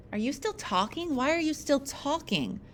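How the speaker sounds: high-pitched pestering questions; needling sibling. Acted. Siblings squabble at dinner table.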